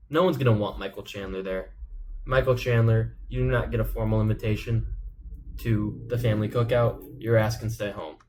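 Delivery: ordinary talk, nothing marked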